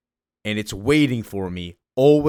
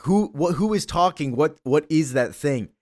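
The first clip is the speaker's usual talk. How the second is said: panicked; 5 semitones higher than usual. Retold or imitated. John panicking at the voice in his head, high and frantic. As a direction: blurt it out, scared and lost.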